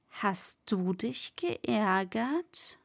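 slow, breathy whisper. Acted. Master whispering his taunt to the servant, slow. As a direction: hiss the taunt slowly under the breath.

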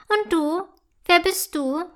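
lightly voiced inquisitive elf; curious little elf. A narrator's reading. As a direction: ask eagerly in a small elf voice. Elf questions the mushroom.

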